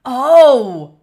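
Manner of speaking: loud, drawn-out oh of realization; surprised. Real, not acted. Guest told they sound like a friend, surprised. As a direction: react with a big loud "oh". Reacts to being told of resemblance.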